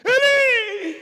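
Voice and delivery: high, loud, drawn-out Eli; anguished shout. Retold or imitated. Preacher recalling Jesus' cry for the congregation, loud anguished shout. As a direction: call out the name in agony.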